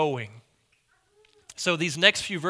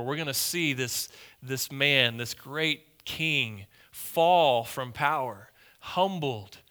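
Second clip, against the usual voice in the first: ordinary talk, nothing marked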